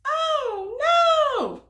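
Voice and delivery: high, loud playful "oh no"; mock dismay. Storyteller to listening kids, hammed-up high alarm. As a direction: gasp it for the kids in fun.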